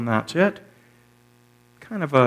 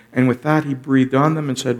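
usual speaking voice

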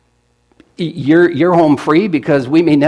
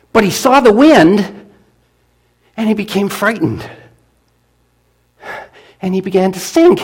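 building drama; 7 semitones higher, 5 dB louder than usual. A narrator's reading. Dramatic turn in the story.

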